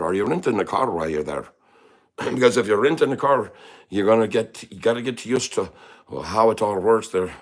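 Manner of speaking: lilting put-on Irish accent; playful Irish brogue. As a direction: chat like a friendly Irishman. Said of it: "me Irish accent".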